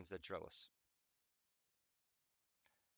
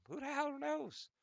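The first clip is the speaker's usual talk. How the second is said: very high, slow squeak; squeaky mock answer; 4 dB louder, slower than usual. Answering nagging relatives, high squeaky mock retort. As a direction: squeal it like a cartoon.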